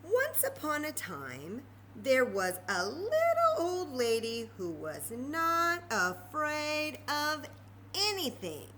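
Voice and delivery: slow, sing-song storytime opening; storybook narration. A narrator's reading. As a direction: draw the children into the tale slowly. Reading story to children.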